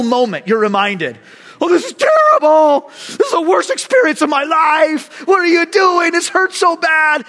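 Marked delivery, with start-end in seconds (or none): 1.6-7.2 loud, high hammed-up wail of complaint; flesh crying out. The flesh complaining to the fasting believer, hammed-up loud wail. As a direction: shout like a starving body begging. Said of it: "your flesh is crying out".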